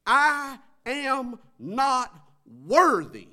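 high, loud pleading cry of a humble soldier; centurion voice. Acted. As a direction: cry out unworthiness loudly. Preacher voicing the centurion.

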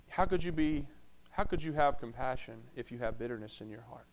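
quiet pastoral appeal; soft pleading. Acted. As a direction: ask it gently into their hearts.

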